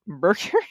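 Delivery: "Mercury" tentative and nearly voiceless, rising like a question; whispery, doubtful attempt at "Mercury"; stumbling pronunciation. Real, not acted. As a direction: falter on "Mercury", unsure it came out right. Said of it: "I can't do it".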